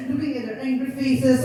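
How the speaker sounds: plain reading voice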